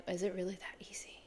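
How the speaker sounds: hushed, breathless whisper, quick; soft amazed whisper; whispered disbelief. Retold or imitated. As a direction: whisper it like a secret you can't believe. Disbelief after coach's reply.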